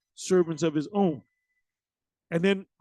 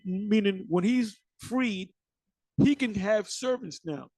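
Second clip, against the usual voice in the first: ordinary talk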